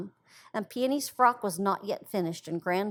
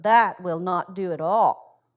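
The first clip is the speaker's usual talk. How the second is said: slow, louder, grave little lecture; grave wisdom; 2 semitones lower, 8 dB louder, slower than usual. A narrator's reading. Violet gravely correcting little Peony, slow and weighty. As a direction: say it slowly like a wise elder sister. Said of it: "answered Violet with grave wisdom".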